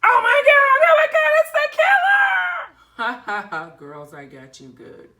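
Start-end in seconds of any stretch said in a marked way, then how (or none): 0.1-2.8 shrieking high and loud in terror; panicked screaming. Acted. Girls spot the masked killer. 3.0-3.7 loud, triumphant ha ha ha; gloating prank laugh. Acted. Brother reveals his prank.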